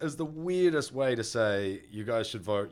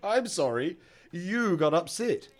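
loud, raised, drawn-out non-apology; arrogant mock apology; 6 semitones higher, 7 dB louder, slower than usual. Friend mimics someone apologising for the other's upset, mock-arrogant. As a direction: act out an insincere apology, pitched up. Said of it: "psychopath apology is what that is".

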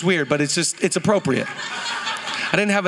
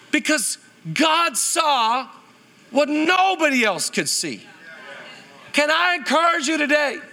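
preacher building to full force; preaching; 5 semitones higher than usual. Acted. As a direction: lift the room with conviction. Preaching encouragement to congregation.

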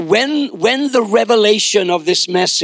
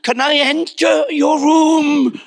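put-on high, timid child voice; hammed-up cowering little boy; mock terrified son; 8 semitones higher than usual. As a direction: squeak it out humbly, afraid of the answer. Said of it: "shaking in his shoes".

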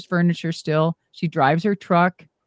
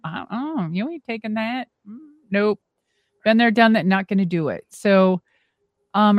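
normal talking voice

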